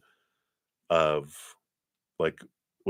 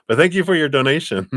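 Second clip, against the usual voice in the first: higher, louder, quick and cheerful, no French accent now; bright thanks; 10 semitones higher, 7 dB louder, faster than usual. Shown as a demonstration. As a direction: toss off the thanks fast and bright. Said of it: "That's my French imitation anyway".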